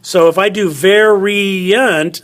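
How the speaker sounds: variant said slowly, each syllable drawn out; sounding out variant. Shown as a demonstration. Teacher sounding out variant for the class. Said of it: "it's ver, E is its own syllable".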